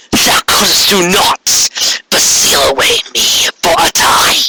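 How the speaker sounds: tower over a fallen sister; Corona, proud and mournful. Acted. Corona lectures her sister.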